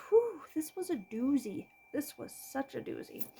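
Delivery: drained, whispered sigh of relief; exhausted unvoiced aside; breathy whisper. Real, not acted. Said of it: "I am done being sick".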